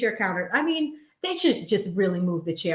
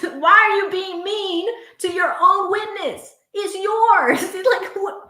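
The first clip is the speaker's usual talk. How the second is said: high, loud laughing protest; laughing disbelief; 9 semitones higher, 6 dB louder than usual. Real, not acted. Amused disbelief at the guest.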